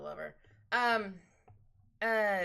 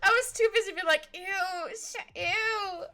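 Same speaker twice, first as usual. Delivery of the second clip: laughing; 12 semitones higher, 7 dB louder than usual. Real, not acted. Laughing at her own reaction.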